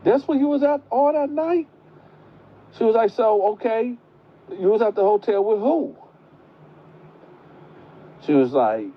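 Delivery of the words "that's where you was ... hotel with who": very high, startled disbelief; grandma hearing about the hotel, shrill shock. Retold or imitated. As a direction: squeal it out in disbelief. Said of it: "Grandma looking like".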